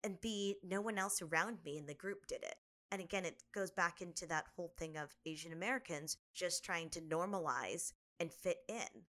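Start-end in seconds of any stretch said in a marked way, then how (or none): none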